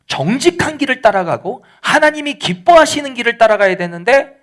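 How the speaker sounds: preaching at full force. Real, not acted. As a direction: build the sermon to a passionate peak. Preaching climax, raised voice.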